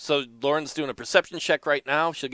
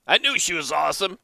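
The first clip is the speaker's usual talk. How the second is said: ordinary talk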